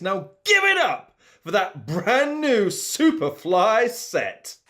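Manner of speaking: ordinary talk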